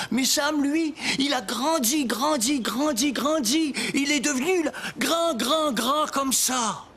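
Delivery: excited. Acted. Telling of a giant friend.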